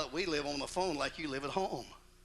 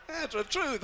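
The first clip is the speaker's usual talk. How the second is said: words through laughter; laughing; 13 semitones higher, 4 dB louder than usual. Real, not acted. Preacher admitting the joke's truth, laughing.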